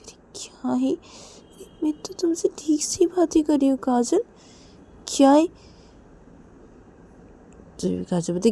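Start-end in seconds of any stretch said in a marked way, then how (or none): 0.0-5.5 put-on higher, half-whispered girl; light reply. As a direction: brush it off. Reshma replying, partly whispered.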